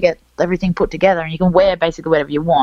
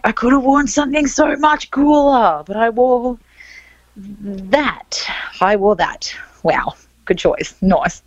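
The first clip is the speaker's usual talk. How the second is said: sarcastic self-scolding; 5 semitones higher, slower than usual. Retold or imitated. Retold self-scolding about outfit.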